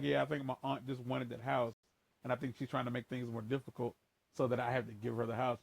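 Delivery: quoting himself talking calmly to a stranger; calm polite public voice. Retold or imitated. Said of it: "me being professional in a public setting".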